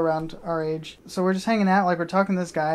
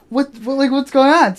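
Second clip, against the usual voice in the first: higher, louder startled question; alarmed reaction; 7 semitones higher, 7 dB louder than usual. Retold or imitated. Running toward a scream at the window, alarmed. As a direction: reenact a stammered, rising alarm.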